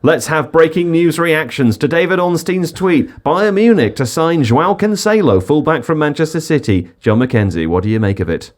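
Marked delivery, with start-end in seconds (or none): 0.0-6.9 breaking news read. Pretend. Mock breaking news announcement.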